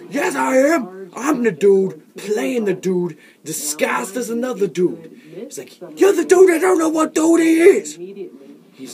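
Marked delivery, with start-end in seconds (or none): 0.1-5.2 a higher, louder indignant insistence; excited insistence. Acted. 6.0-8.0 shrieking high and loud; a high, loud panicked shriek; high yell. Acted. Character yells back high.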